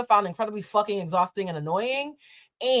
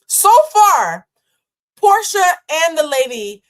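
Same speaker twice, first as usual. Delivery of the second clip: so far, thrown out louder and higher; exasperated outburst; 6 semitones higher, 9 dB louder, slower than usual. Real, not acted. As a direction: burst out at how bad they both are. Building outrage at both passengers.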